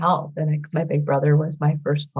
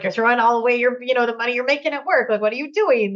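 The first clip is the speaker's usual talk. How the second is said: higher, faster mock scolding; imitating him scolding; 5 semitones higher, faster than usual. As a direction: rattle off his scolding in a raised, quick voice. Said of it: "he was like".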